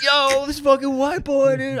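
high, slow drawn-out hype voice; imitated hype guy. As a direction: drag it out like an amazed bystander. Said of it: "they were like".

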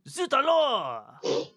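slow, drawn-out stage-French cry; mock French exclamation. Player reacting to the fallen object, hammed-up French accent. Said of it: "speak like a, a Frenchman".

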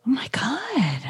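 breathless whispered disbelief. Real, not acted. Hearing the brother's pleas in the recap, whispering in disbelief.

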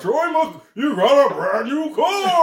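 high, loud, slow game-show host; hammed-up announcer shouting the prize; Troy McClure voice. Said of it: "It wasn't a Troy McClure!".

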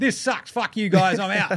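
petulant star. Retold or imitated. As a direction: storm off like a spoiled player. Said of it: "It's like".

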